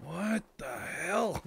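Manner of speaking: a lightly acted, slow confused what the hell; mock baffled. Gamer imitating himself lost in Little Canada, mild mock confusion. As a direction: drawl it out like a lost tourist. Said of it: "me wandering around ... going".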